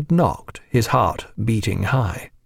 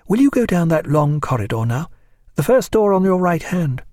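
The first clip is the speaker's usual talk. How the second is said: higher-pitched, polite woman's instructions; Clarissa giving directions; 6 semitones higher than usual. A narrator's reading. Hostess sending servant down the corridor, higher polite voice. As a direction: direct him courteously in a raised voice.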